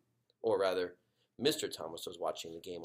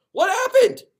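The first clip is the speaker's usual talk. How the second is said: much higher, loud cry; alarmed mom; 20 dB louder than usual. Acted. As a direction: rush in calling in alarm.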